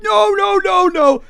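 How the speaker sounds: very high, loud string of yelps; hammed-up panicked squeal; mock panic. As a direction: imitate a man fleeing in alarm. Man fleeing police, imitated.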